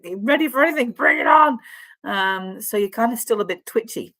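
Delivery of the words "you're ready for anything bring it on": high, loud, put-on bravado; mock fighting challenge. Reader voicing the twitchy client, high mock-combative shout. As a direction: play someone spoiling for a fight.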